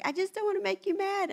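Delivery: high-pitched tearful whine; mock weepy child pleading; imitated crying. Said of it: "She literally started crying".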